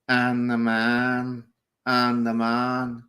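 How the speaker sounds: slow, stretched-out words held on a level note; drawn-out quote. Retold or imitated. Quoting his comment from the stream, slow and drawn out. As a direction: drag each word out as a quote.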